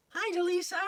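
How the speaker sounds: puppet voice pitched well up; Rocky the puppet. Acted. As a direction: chirp a greeting as the puppet Rocky. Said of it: "This is Rocky".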